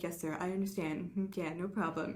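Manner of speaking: soft, mumbled mock obedience; meek yes-man. Steve mocked as meek to the generals, softly. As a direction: cower like a meek subordinate. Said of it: "just like".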